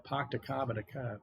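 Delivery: R-dropping Boston accent, tossed off softly; quick aside in a demo Boston accent. Shown as a demonstration. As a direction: put on a Boston accent and drop every R. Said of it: "try to do my best Boston accent".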